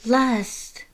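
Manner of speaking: high, bright, delighted call of a name; light up at seeing an old friend; excited friend voice. Acted. Frisk spotting Lust, high excited call.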